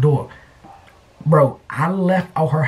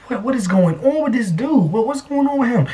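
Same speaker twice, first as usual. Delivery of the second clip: high baffled question; baffled bystanders; 8 semitones higher than usual. Retold or imitated. Neighbors staring at a man in boxers, asking in high disbelief. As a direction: ask it in disbelief. Onlookers puzzled at him.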